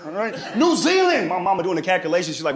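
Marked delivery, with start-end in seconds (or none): 0.6-1.3 high, pleading "New Zealand"; mock despair from the uncle; uncle's high frantic cry.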